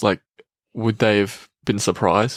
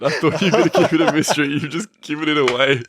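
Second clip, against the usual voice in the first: laughing; 5 semitones higher, faster than usual. Real, not acted. Co-host gave the answer away.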